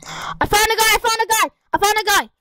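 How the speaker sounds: high, urgent repeated callout; excited callout. Real, not acted. Gamer spots an enemy mid-match, high excited callout.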